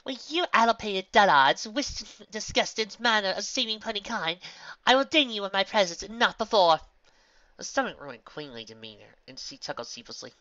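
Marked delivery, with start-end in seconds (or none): 0.0-6.8 high, loud regal declamation; slow, grand pronouncement from an offended queen; haughty queen. Acted. Haughty queen figure speaks.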